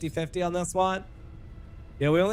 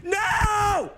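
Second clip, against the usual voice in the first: panicked; 13 semitones higher than usual. Real, not acted. Panic over flashlight.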